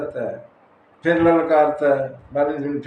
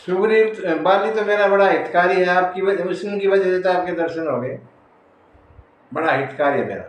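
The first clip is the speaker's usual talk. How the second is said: higher, louder, hurried insistence; eager backtracking; 5 semitones higher, 4 dB louder than usual. Acted. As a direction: rush to praise your supposed enemy. Acts Sugriv suddenly changing sides. (जोश में पलटकर सफ़ाई देते हुए)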